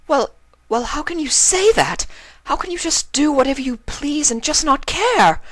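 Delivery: high, loud, furious outburst; fuming. Acted. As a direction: hurl the questions at her, outraged. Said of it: "Octavia was fuming now".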